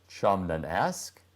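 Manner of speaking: plain reading voice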